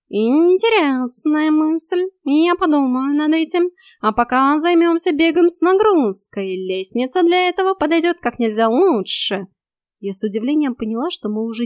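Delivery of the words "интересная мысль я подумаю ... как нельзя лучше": high, drawn-out musing turning to instruction; raised feline character voice; smug cat coach. A narrator's reading. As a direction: muse teasingly as a bossy cat. Cat coaches the chasing narrator.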